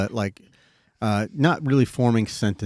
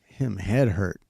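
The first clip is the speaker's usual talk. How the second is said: lightly voiced toddler, simple words; a hint of a small child saying it; baby talk; slower than usual. Dad retelling his daughter's eerie words in light baby talk. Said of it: "I'm gonna repeat it in baby talk".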